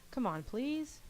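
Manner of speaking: high-pitched wheedling plea; coaxing, drawn-up girlish please; pleading. Acted. Sayori pleads with him to join.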